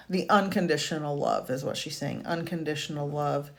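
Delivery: ordinary delivery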